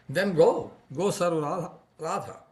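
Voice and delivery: sharp, high jealous retort; loud, jealous outburst at her lover; jealous anger. A narrator's reading. Lover's jealous outburst at Krishna, loud. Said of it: "with jealous anger".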